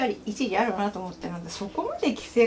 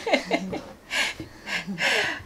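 soft, high giggling; real laugh at a friend's bad luck; amused giggle; 6 semitones higher, 5 dB quieter than usual. Real, not acted. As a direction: giggle softly and let it run on. Laughs at co-host's low view count.

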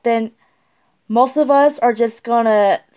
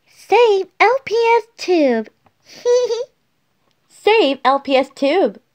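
practicing a speech; 8 semitones higher than usual. Shown as a demonstration. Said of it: "Wait, did I say L P S?".

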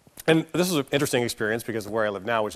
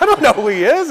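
high, loud laughing protest; laughing denial; 13 semitones higher, 12 dB louder than usual. Retold or imitated. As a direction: laugh it off, backing away. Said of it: "I'm like".

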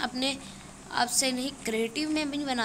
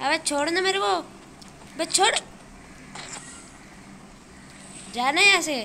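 exasperated shouting; 5 semitones higher than usual. Real, not acted. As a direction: shout at them to back off. Telling attackers to leave him alone. (झुंझलाकर ऊँची आवाज़ में)

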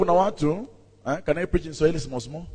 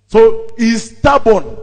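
stubborn said slow, higher and much louder; emphatic; 6 semitones higher, 10 dB louder, much slower than usual. Real, not acted. Preacher telling congregation the man's flaw, slow loud emphasis. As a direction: drive the word stubborn home.

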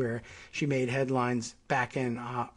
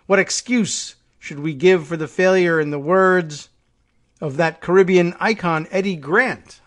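reading a leader's speech aloud with a measured, declaiming tone; formal speech read; 5 dB louder than usual. Acted. Reading the prime minister's climate speech to viewers, formal oratory. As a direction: deliver it like an address to world leaders.

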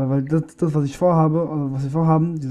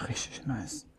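whispered; 6 semitones lower, 17 dB quieter, much slower than usual. Real, not acted. Quiet aside while playing.

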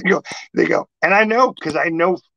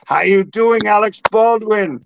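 higher, louder mock shop greeting; hearty imitated greeting; 6 semitones higher, 4 dB louder than usual. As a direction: call out like greeting a regular. Imitating pizzeria staff's greeting.

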